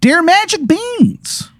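put-on slow, squeaky-high Maryland accent; worst Maryland accent. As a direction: announce it high and drawn out, broad Maryland. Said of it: "The man with the worst Maryland accent".

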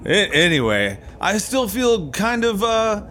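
high, hammy spooky show host; jack o lantern voice. Acted. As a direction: camp Halloween host voice, playing it up. Halloween show host persona.

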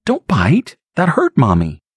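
put-on high, hurt scolding of a baby; scolding mother. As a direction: tell the baby off, stung.

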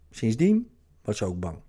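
normal talking voice

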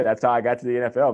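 ordinary delivery

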